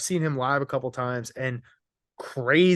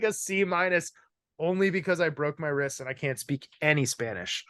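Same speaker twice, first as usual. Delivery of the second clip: usual speaking voice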